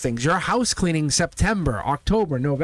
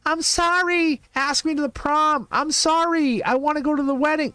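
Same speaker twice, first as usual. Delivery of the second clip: high, pleading mock voice; whiny old-school lover; 10 semitones higher than usual. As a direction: play the clingy ex, pitched way up. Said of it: "this is not the old school".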